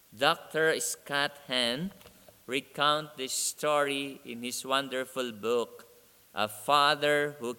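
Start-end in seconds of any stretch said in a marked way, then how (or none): none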